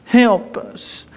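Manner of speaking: mock plea. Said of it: "You want somebody to come feel sorry".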